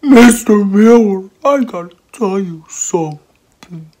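slow, drawn-out pupil voice; whiny pupil. Acted. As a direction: pester the teacher in a slow whine. Pupil pestering busy teacher.

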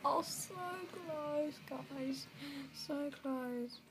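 high, drawn-out whimper after a sobbing burst; mock crying. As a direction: whimper over the narrow miss. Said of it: "but I'm crying".

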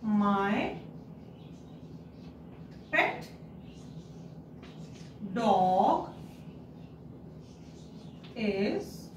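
one word at a time, long gaps; slow dictation. Shown as a demonstration. Teacher dictating a sentence to pupils, word by word. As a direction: dictate it slowly so children can write along. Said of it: "So let's write".

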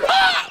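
very high, short shriek; sudden high yelp; startled scream. Real, not acted. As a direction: scream at a jump scare. Game jump scare.

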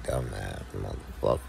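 low, soft, dismissive brush-off; a low, hushed put-down of a dumb friend; quietly telling a fool he can't hang; imitating himself dismissing a friend. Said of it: "I'd be like".

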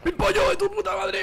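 high, shouted curse; furious shout. Real, not acted. Gamer cursing at his dying character, shouting. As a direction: let the curse rip at full pitch. Outburst at the game.

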